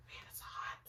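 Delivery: a breathy whisper under her breath; whispered aside. Real, not acted. Streamer complaining to herself about the heat, whispering. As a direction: mutter it off-mic.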